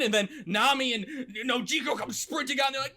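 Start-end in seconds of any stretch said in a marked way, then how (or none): none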